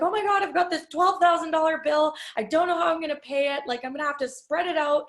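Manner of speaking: calling dad about a huge tax bill, high and hurried; retold panic. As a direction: rattle it off in a mock panic. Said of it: "I called him and I was like".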